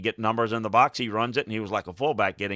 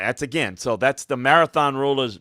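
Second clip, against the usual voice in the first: ordinary talk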